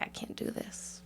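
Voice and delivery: whispering. Retold or imitated. Acting out a dancer's self-doubt.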